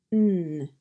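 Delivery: N hummed as "nnn" through the nose; isolated, sustained "nnn" for N; nasal N sound. Shown as a demonstration. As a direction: hold N as a pure sound, no vowel. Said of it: "start with the sound of N".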